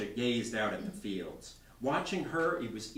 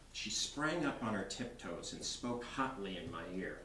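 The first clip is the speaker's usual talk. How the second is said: ordinary delivery, nothing marked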